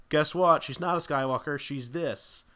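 loud, high teasing surprise; spring the twist loudly like a gotcha; mock reveal. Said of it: "So then later they can go".

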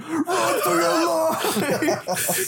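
high, slow mock panic with a laugh; panicked yell. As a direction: wail it like a fleeing Santa. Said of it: "He's like".

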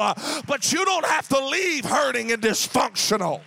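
loud preaching climax. Acted. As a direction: build the call to the altar. Preaching climax over music.

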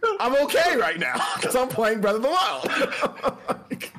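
high, slow, laughing through the words. Real, not acted. Joking about losing a job over a game, laughing. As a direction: crack up while finishing the joke.

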